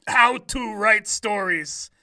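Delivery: a hint of a Russian accent; lightly put-on Russian accent. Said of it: "read this in, like, a Russian accent".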